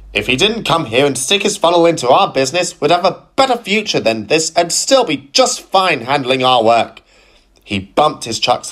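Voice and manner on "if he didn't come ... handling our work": ranting. Acted. Philip ranting about tram.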